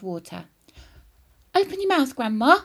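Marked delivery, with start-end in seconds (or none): 1.5-2.6 loud, raised urgent call; boy crying out. A narrator's reading. George with water for burning grandma, crying out. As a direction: cry out the order. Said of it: "he cried".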